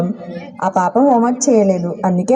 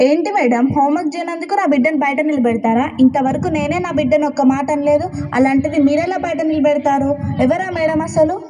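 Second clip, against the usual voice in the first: indignant father; 6 semitones higher than usual. Acted. Angry father confronts principal.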